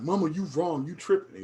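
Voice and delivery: disrespectful talking back. Shown as a demonstration. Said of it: "depends on how you do it".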